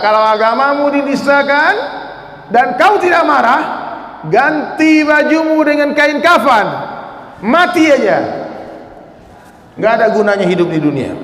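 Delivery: blaze it out like a rally cry; fiery quote. Retold or imitated. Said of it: "Kata Buya Hamka".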